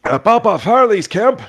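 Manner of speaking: put-on high, loud, folksy old-timer voice; squeaky cheerful old boggart greeting travellers. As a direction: play the friendly old fisherman by the fire. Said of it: "He goes".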